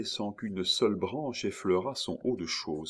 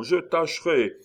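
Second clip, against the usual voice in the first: raised, effortful repeat; straining effort; 8 semitones higher, 7 dB louder than usual. A narrator's reading. Miton struggling at the hedge, straining. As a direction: say it while heaving yourself up. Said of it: "il fit de nouveaux efforts".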